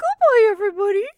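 very high, loud puppet squeal; high-pitched cartoon puppet farewell. Shown as a demonstration. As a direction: squeak it out like a talking animal. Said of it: "Say goodbye to everybody in that voice".

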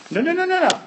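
high-pitched rapid string of no's; emphatic objection. Acted. Preacher cuts off imagined objection.

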